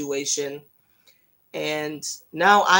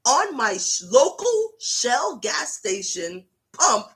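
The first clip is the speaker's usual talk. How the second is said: ordinary talk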